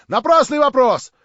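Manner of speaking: loud, high indignant protests; voices calling out. A narrator's reading. The gathering rejects the question, in loud indignant cries. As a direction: throw out objections from around the room. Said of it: "послышались опять голоса".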